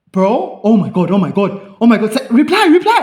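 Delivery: loud, high, laughing excitement; over-the-moon friend. Retold or imitated. A friend urging him to reply, ecstatic. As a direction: gush like your friend just won.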